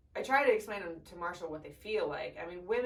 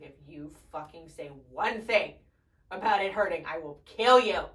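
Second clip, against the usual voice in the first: retold threat; 2 semitones lower, 8 dB louder than usual. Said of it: "I was thinking in my head".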